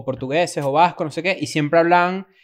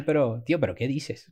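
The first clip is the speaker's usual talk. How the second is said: put-on Spanish accent; 5 semitones lower, 10 dB quieter than usual. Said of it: "en España es donde de verdad".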